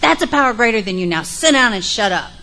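loud, fast exasperated order; frustrated counselor. Retold or imitated. Frustrated counselor ordering Joe to sit down, loud. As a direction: snap it out, fed up. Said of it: "she's like".

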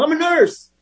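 high, pleading mock drunk whine; hammed-up drunk begging the cops; drunk protest. Drunk nurse pleading with the police, high mock whine. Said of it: "Why don't you yell this a few times".

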